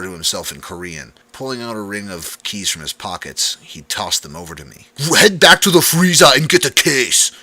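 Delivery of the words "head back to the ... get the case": growled. A narrator's reading. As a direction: bark the order gruffly. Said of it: "he growled".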